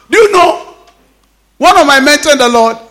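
ordinary delivery

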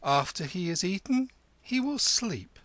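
high-pitched, haughty reply; lofty. A narrator's reading. Kim putting off the fawning hillman, lofty. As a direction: put him off grandly. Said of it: "Kim returned loftily".

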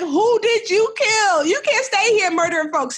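very high, loud and slow, teasing alarm; mock outrage. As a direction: tease her like she's done a murder. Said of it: "I was like".

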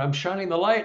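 ordinary talk

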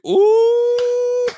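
very high, loud, drawn-out "ooh" into laughter; long falsetto whoop breaking into a laugh; excited whoop. Real, not acted. Host reacting to a victory drink question, excited high whoop.

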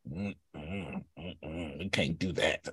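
quiet mock refusal hummed; mock disapproval. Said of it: "instead of Tracy like".

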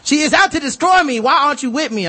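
hammed-up high, loud rant imitation; mock furious Karen. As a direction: play her wound up and ranting, hammed-up. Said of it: "She's like".

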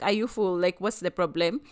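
mock dismissive. Mocking colleague's dismissive reply.

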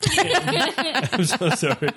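high, drawn-out apology through laughter; embarrassed apology. Retold or imitated. Friend mimicking a woman apologizing for her, embarrassed and laughing. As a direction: imitate a flustered, giggly apologizer, very slowly. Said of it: "she'll turn bright red and be like".

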